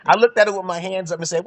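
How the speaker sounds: ordinary talk, nothing marked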